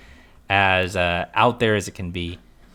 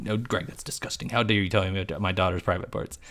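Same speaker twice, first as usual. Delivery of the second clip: mock Jack; 2 semitones lower, 6 dB quieter than usual. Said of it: "what was he supposed to say".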